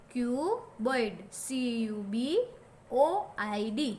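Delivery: put-on letter by letter for young kids; slow, clear spelling drill. Shown as a demonstration. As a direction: spell each letter slowly. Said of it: "ऐसे याद रखना है".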